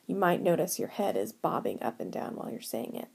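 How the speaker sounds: ordinary talk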